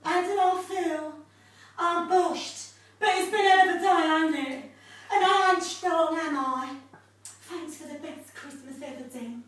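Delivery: high, laughing Cockney soap wife; hammed-up tipsy Ange; Angie from EastEnders. Ange performing the soap scene opposite Den, tipsy. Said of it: "I'm playing Ange".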